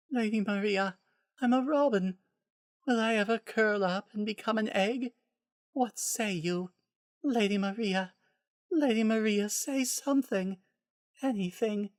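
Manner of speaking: high, soft, unhinged begging; deranged patient voice. A narrator's reading. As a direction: babble madly at Lady Maria. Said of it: "She said nothing".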